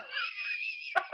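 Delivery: sudden high-pitched laugh; high burst of laughter. Real, not acted. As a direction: let a squealing laugh out. Laughing at own mistake.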